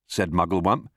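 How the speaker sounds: usual speaking voice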